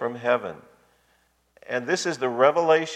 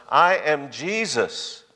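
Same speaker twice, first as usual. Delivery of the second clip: solemn, deliberate self-revelation; Jesus declaring himself; 3 semitones higher than usual. Retold or imitated. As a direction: draw out each word of the name, then lift the voice. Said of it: "notice what he says".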